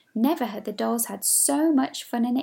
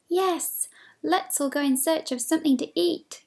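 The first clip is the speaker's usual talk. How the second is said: higher, eager dolls all together; excited chorus; 7 semitones higher than usual. Acted. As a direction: cry it out with eager joy. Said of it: "cried all the other dollies".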